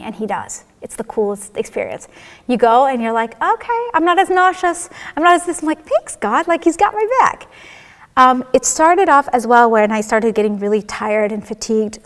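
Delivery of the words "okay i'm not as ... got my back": higher-pitched, pleasantly surprised relief; relieved. Retold or imitated. Patient realizing God took the nausea away, relieved. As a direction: lift it high with grateful amazement. Acts out relief after treatment.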